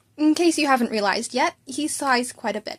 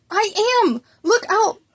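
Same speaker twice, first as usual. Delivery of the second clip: much higher and louder, bursting; eager shout; 10 semitones higher, 7 dB louder than usual. Acted. Boy eager to prove it to his teacher, loud. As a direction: jump in like a kid showing off.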